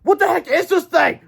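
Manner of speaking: put-on very high, loud astonished kid; amazed kid. Boy staring at the creature, high astonished. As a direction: gasp it out in disbelief.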